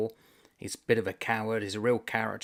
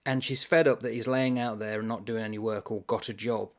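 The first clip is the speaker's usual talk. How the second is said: ordinary talk, nothing marked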